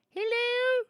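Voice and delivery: very high put-on falsetto; a loud, high, squeaky hello; Jamie's double voice. Streamer slips back into the character voice, very high. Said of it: "Jamie Double voice".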